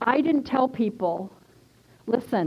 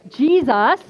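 loud, raised cry of praise; acted jubilant shout; exclaimed praise; 4 semitones higher, 7 dB louder than usual. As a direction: call out the name loudly. Crying out at deliverance, retold.

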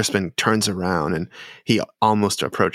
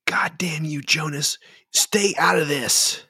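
high-pitched, angry protest; Florin; 8 semitones higher than usual. Acted. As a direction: curse him in a higher, strained voice. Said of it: "and he says".

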